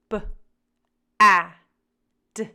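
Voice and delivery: B, A, D as separate sounds, pauses between; short B, loud short A, short D; sounding out. Shown as a demonstration. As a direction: say B, A, D as sounds, not names. Sounding out a word to blend.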